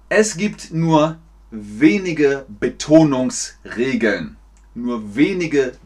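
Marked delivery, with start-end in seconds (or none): 0.1-4.4 slow, deliberate, word by word; slow teacher delivery. Shown as a demonstration. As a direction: pace it out carefully for learners. Langsam für Lernende vorgesprochen. (langsam, Wort für Wort)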